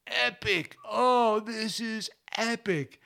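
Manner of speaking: put-on high, stretched-out kid's awe; imitated excited kids. Host imitates kids watching first sunrise, excitedly awed. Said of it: "the typical word that kids are using now".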